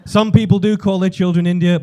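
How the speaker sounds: usual speaking voice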